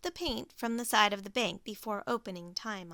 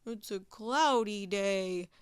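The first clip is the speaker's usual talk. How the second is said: grumbling Walrus; 2 semitones lower, slower than usual. Acted. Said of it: "grumbled Walrus".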